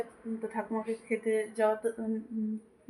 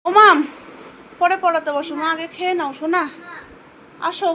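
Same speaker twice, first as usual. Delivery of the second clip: loud, higher call across the house to her daughter; 5 semitones higher, 9 dB louder than usual. Real, not acted. Mother calling her daughter to come and eat, loud call.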